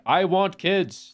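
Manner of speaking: high, slow, gushing want; hammed-up eager declaration; eager overdone. Shown as a demonstration. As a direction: stretch it out like you're dying for kids. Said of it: "not going out there being like".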